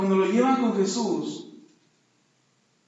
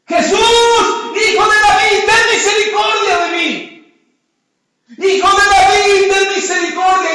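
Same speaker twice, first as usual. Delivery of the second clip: high, full-voiced desperate cry; louder shout; 11 semitones higher, 12 dB louder than usual. Blind Bartimeo cries to Jesús for mercy, shouting loudly. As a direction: shout it out, stretched and high, over a crowd. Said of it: "Gritó más fuerte".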